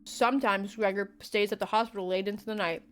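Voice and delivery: ordinary delivery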